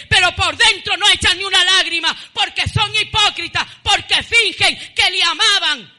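loud, driving preacher denouncing hypocrites; preaching at full force. Acted. Preacher rebukes mourners who fake their tears, full-force preaching. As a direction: hammer it home from the pulpit.

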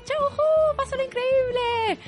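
high, loud cheerful call; excited shout-out. Real, not acted. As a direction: call out to her brightly. Cheerful shout-out to absent co-host.

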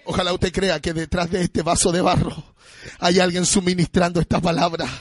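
usual speaking voice

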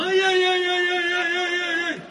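high wailing ay ay ay, hammed-up; fake wailing fortune teller. Old fortune teller begging, hammed-up high wail. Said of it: "te viene siempre así como".

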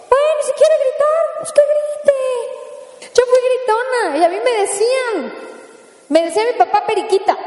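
easygoing relative. Acted. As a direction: shrug it off, let the kid yell. Relaxed relative allowing shouting.

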